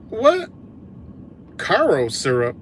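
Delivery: genuinely stunned; shocked disbelief. Real, not acted. Reacting to the syrup news, stunned disbelief.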